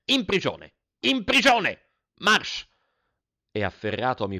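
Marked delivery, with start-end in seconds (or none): 0.1-0.7 loud furious command, high; angry order. Acted. Headmaster orders the boy to prison, angry. 1.0-2.7 much higher, louder furious scream; top-of-lungs shout. Acted. Headmaster screams the sentence again, at full fury.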